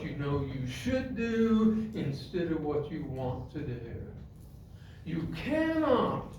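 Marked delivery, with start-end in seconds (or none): 5.0-6.3 high, slow and deliberate; emphatic preaching. Real, not acted. Preacher forbidding listeners to dwell on upsets, emphatic preaching. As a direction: lay down the rule with weight.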